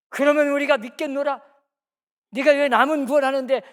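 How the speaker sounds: loud jeering crowd voice, sneering; mocking taunt. Preacher voicing the mockers jeering at Jesus on the cross, loud sneer. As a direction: jeer at him like the mob.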